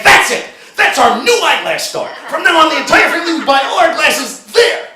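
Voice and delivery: high, loud mock proclamation; ranting to his wife about the new store; loud triumphant rant. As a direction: declare it grandly in a huff.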